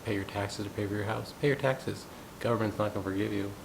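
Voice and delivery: ordinary talk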